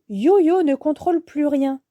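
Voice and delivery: usual speaking voice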